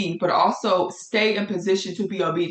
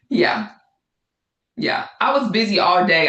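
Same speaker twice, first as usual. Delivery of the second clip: usual speaking voice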